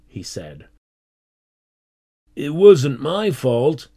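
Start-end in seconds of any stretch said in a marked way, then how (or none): 2.4-3.9 loud, higher-pitched, slow protest. A narrator's reading. Tony blamed by his teacher, protesting. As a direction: protest your innocence, stretching the words. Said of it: "protested Tony".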